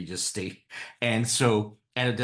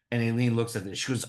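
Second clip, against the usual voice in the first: ordinary talk, nothing marked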